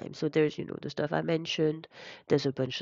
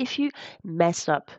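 usual speaking voice

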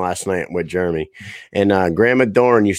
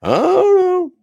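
put-on much higher, thin, quavering old-man voice; imitating old grandpa; 5 dB louder, slower than usual. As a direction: squeak it out like a frail ninety-seven-year-old. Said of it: "this is how he talks".

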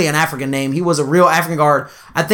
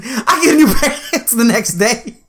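laughing; 6 semitones higher than usual. Real, not acted. Laughing while telling story.

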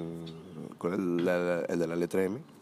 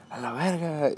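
put-on drunk dismissal; 7 semitones higher, slower than usual. Drunk friend dismissing warning.

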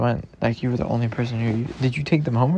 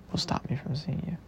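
soft unvoiced whisper, lingering; hushed devoted whisper; 9 dB quieter than usual. Real, not acted. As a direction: breathe the promise right into her ear. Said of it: "And by that, I mean".

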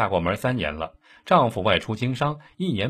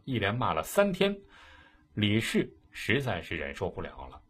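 ordinary delivery, nothing marked

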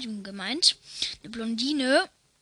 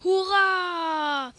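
hoher, lauter Torjubel; brüll es raus wie beim Siegtor; verrückter Jubelschrei; 6 semitones higher, 3 dB louder than usual. Acted. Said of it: "schreit wie verrückt". (Vater jubelt beim Fußballspiel am PC, lauter Jubelschrei)

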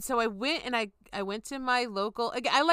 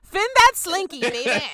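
flirty joke; 7 semitones higher, 7 dB louder than usual. Pretend.

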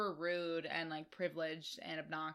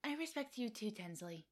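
high, soft impression, dropping away on the name; Dorinda; 3 semitones higher, 6 dB quieter than usual. A housewife answering Tinsley's peace offer, badly imitated. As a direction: mimic her in a small high voice. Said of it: "And Dorinda's like".

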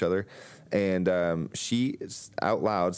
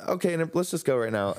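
eager agreement; 9 semitones higher than usual. Retold or imitated. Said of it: "And I was like".

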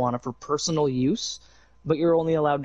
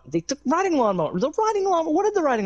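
mock outrage; faster than usual. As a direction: cry out in fake shock. Mock outrage over lawnmower.

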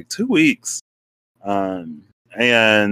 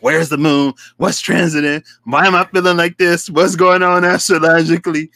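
higher, louder, building retold questions; worked-up questioning; 6 semitones higher, 6 dB louder than usual. Emotional friend asking the sky for answers, worked-up questioning. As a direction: fire off mock-desperate questions, rising in pitch. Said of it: "I'm still emotional".

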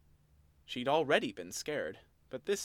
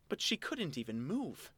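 ordinary delivery

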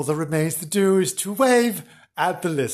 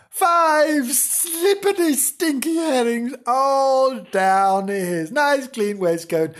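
high, slow, stretched-out storytelling; drawn-out relish; 9 semitones higher, slower than usual. A narrator's reading. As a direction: draw out each slippery word, starting high. Gleeful telling of the mishap.

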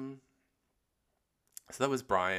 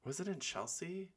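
ordinary talk, nothing marked

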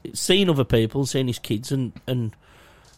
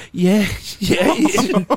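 tearful reply; 8 semitones higher, 5 dB louder, much slower than usual. Retold or imitated. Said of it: "And I went".

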